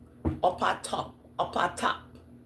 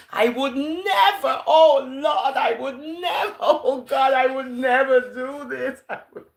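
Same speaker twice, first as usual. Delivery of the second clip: high, loud, slow mock protest with laughs; hammed-up denial; 8 semitones higher, 6 dB louder than usual. Peter swearing to Jesus he won't deny, hammed-up high protest. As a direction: overplay the innocent disciple.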